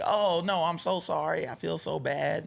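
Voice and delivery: high mock apology; sorry-sounding high voice; mock embarrassed apology; apologetic texter. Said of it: "the person was like".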